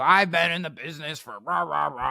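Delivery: lightly voiced blustering old guy; mocking old-timer. Old heckler boasting of experience to the speaker, mock bluster. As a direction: imitate an old-timer's tirade trailing into nonsense.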